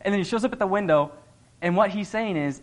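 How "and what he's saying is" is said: usual speaking voice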